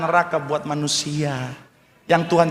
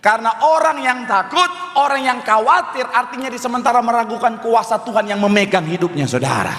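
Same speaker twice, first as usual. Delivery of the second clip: fervent, raised-pitch preaching; 5 semitones higher than usual. Real, not acted. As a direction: drive it home to the congregation. Preaches at full force. (khotbah berapi-api dengan nada tinggi)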